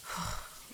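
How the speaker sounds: long, soft exhale; weary sigh. Acted. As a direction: sigh out the exasperation. Queen alone after tiresome meeting.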